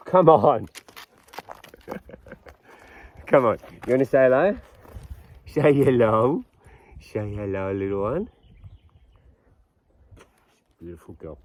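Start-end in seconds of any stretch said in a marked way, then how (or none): 5.5-11.4 soft, low cooing to a pet. Real, not acted. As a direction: coo gently at the puppy.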